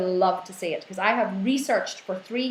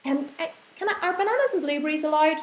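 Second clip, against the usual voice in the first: timid asker voice; 8 semitones higher than usual. Retold or imitated. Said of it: "they go".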